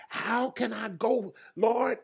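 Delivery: high, slow anxious question; fearful plea. Retold or imitated. Samuel anxiously asks God how he can go. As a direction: ask it high and scared, drawn out.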